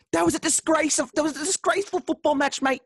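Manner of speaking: put-on high-pitched, outraged Scouse accent; Jeremy Carragher. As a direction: rant like an indignant Liverpool pundit. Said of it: "It's a good Jeremy Carragher impression".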